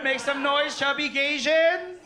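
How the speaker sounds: high, loud hype call to the crowd. Real, not acted. Comic hyping the audience, loud high call. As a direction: rile the crowd up.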